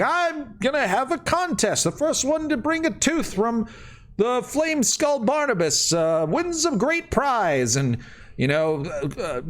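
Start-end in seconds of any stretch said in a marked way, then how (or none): 0.0-7.8 much higher, hammed-up carnival barker; showman announcing a contest. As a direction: pitch it high like a huckster drawing a crowd. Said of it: "then you start saying".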